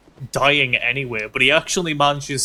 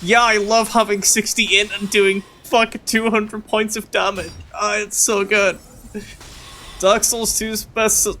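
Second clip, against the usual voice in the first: sarcastic praise; 7 semitones higher than usual. Real, not acted.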